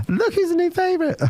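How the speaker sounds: high, sing-song gloating taunt; high teasing voice. Retold or imitated. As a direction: tease him in a high voice. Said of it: "I went".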